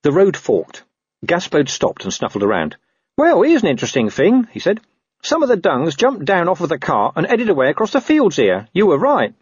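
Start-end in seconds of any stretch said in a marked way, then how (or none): 3.2-9.3 higher-pitched voice of a sniffing dog; talking dog. Acted. Dog tells Carrot which way the tracks go, talking-dog voice. Gaspode the dog reports a trail.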